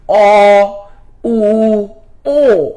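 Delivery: অ, উ, ও held as separate rounded vowels; round-lipped "aw, oo, o", one by one; vowel demonstration. Shown as a demonstration. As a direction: sound out অ, উ, ও with rounded lips. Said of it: "আমার সাথে একটু উচ্চারণ করেন".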